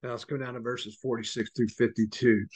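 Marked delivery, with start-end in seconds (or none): 0.0-0.1 low, soft and sincere, drawing them close; tender hush. Real, not acted. Preacher to his congregation about Christ's love, tender and hushed. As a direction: lean in and speak it gently, lower and softer.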